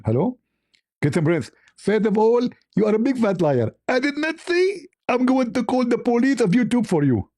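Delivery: high put-on voice of an angry caller; high scolding impression voice; mock Zakir Naik. S0 acts out Zakir Naik phone call.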